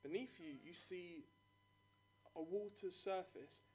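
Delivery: dramatic scene-setting narration; game master narration. Acted.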